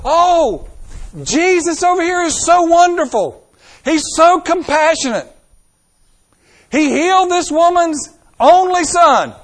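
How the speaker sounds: high, loud gooey mock adoration; hammed-up starry-eyed praise; mock gushing. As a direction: parody swooning churchgoers. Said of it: "little goosebumps jumping up on our arms and go".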